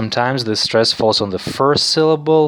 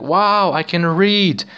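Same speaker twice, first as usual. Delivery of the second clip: higher-pitched, imagined wow of delight; lightly acted amazement, pitched up; mildly amazed learner; 8 semitones higher, slower than usual. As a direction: voice a learner's pleased discovery. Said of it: "you are feeling like".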